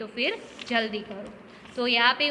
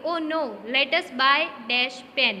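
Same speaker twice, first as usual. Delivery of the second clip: slow reading; 4 dB louder, much slower than usual. Shown as a demonstration. Reads dialogue line slowly.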